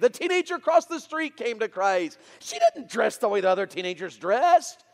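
loud, high, rousing sermon delivery; full-force preaching. Real, not acted. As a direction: build to a preacher's triumphant shout.

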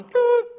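high sharp whistle toot; whistle blast. Retold or imitated. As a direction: mimic a lifeguard whistle. Lifeguard whistle imitated.

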